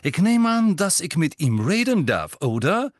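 high, loud, sharp attack; snapping at Hermine. A narrator's reading. Rita snapping at Hermine over a drink, loud and sharp. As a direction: snap the question at her. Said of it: "fuhr sie Hermine an".

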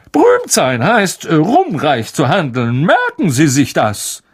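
high, self-important lecture; pompous Lockhart. Acted. Lockhart lectures Harry on fame, high and pompous. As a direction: deliver it as great wisdom.

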